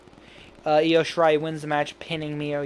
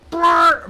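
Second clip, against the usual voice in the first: sound effect; 13 semitones higher, 8 dB louder than usual. Retold or imitated. Imitating a sound in the recap.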